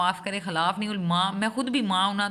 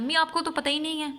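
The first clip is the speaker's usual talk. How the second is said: higher-pitched mock of a dismissive child; mimicking educated children dismissing their parents; dismissive grown child; 8 semitones higher than usual. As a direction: brush mother off, mockingly. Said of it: "جو اپنے parents کو کہتے ہیں".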